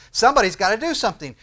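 loud, fast, high complaint; frustrated outburst. Acted. Preacher voicing someone frustrated at events, frustrated outburst.

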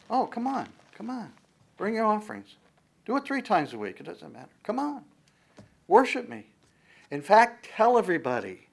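high, mocking sarcastic voice. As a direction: taunt them, dripping with sarcasm. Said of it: "he's being sarcastic".

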